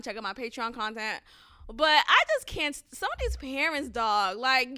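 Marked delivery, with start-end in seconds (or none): none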